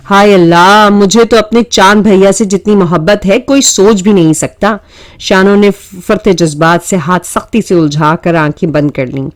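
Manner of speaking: ordinary delivery, nothing marked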